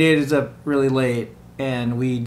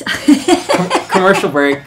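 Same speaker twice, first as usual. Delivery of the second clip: announcer voice; 4 semitones higher, 6 dB louder, slower than usual. Pretend. Mock announcer cutting to ad.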